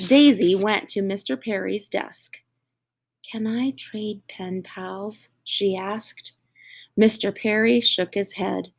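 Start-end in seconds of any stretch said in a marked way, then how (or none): 3.2-5.3 timid request. Acted. Daisy asks teacher, dejected.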